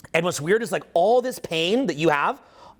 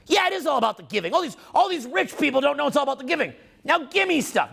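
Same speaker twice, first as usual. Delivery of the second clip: high-pitched mock indignation; mocking entitled people; 7 semitones higher than usual. Speaker mimics people complaining about the rich, high mocking whine. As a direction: mimic a self-righteous freeloader, pitch raised throughout.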